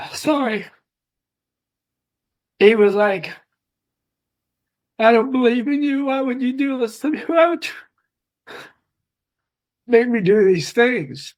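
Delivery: high, cracking voice, close to tears; fighting back tears on every word; voice breaking. Real, not acted. Son retelling his dad's plea to God, voice breaking.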